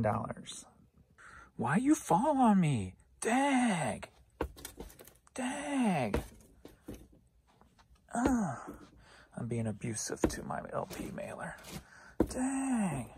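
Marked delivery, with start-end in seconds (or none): 1.6-8.6 exasperated whine. Real, not acted. Item falls over. 12.3-13.1 mock crying. Abusing the mailer.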